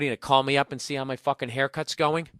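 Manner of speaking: usual speaking voice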